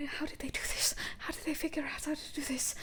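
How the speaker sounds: high, breathless whisper; frightened youngster's hushed wondering; much younger voice. Acted. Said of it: "it's a much younger voice".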